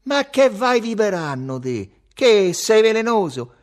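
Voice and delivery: slow, high, loud jeer; shouting. A narrator's reading. Salvatore mocks his friend's song request, shouting. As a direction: yell a drawn-out taunt. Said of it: "e gridò".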